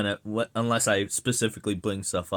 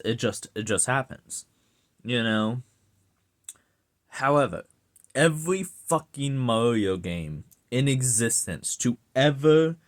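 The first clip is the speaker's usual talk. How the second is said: usual speaking voice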